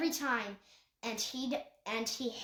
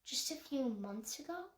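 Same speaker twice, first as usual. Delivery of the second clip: softer, gentle question; hushed, careful question; even more quietly; 7 dB quieter than usual. A narrator's reading. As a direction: ask it softly, barely above a murmur. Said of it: "Jade asked even more qui-, quietly".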